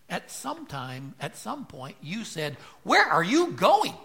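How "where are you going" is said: high, loud exasperated mom calling out; mother's scolding question. Retold or imitated. Preacher voicing a mother scolding her child, high and loud. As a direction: play the mom demanding where the kid's off to.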